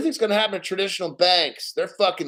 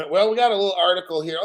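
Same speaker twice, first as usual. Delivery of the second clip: ordinary delivery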